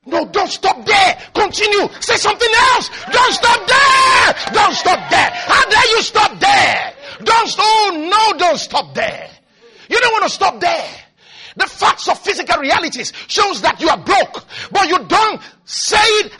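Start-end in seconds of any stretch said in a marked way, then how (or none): 0.1-10.9 fiery shouting. Acted. Pastor forbidding the congregation to stop at lack, fiery shout. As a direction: refuse to let them give up.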